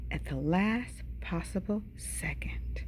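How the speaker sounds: slow, hushed, spaced-out words; hushed suspense. A narrator's reading. Seed spit out just in time, hushed slow suspense. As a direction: stretch it out nearly to a whisper.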